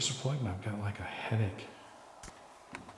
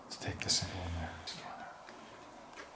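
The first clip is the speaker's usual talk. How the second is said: whispering; 3 semitones lower, 9 dB quieter than usual. Real, not acted. Sneaking, hushed.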